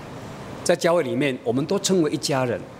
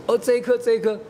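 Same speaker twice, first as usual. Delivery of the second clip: put-on little girl; 9 semitones higher than usual. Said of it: "他就指".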